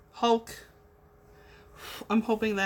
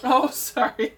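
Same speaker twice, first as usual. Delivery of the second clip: laughing apology; 5 dB louder than usual. Real, not acted. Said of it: "I was all excited about the whole glitter moment".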